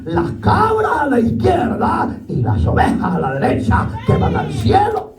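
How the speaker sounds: high, laughing sermon cry; preacher's high ringing shout; fervent preaching. Acted. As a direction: drive the verse home. Preaching at full force.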